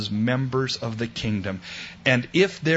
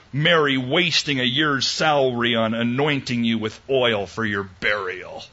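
ordinary delivery

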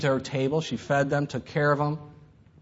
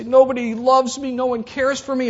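high, loud complaining cry; hammed-up wail of self-pity; loud, high mock protest; 8 semitones higher, 6 dB louder than usual. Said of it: "We say".

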